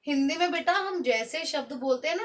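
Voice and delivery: ordinary delivery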